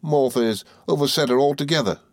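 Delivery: plain reading voice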